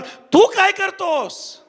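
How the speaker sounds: high, drawn-out challenging question; pointed challenge. Real, not acted. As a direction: confront the listener, stretching the words out. Challenging those boasting of forefathers.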